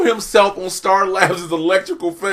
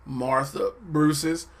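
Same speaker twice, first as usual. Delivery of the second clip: deadpan disbelief; 3 semitones lower, 8 dB quieter, much slower than usual. Real, not acted. Deadpan repeat of the silly name.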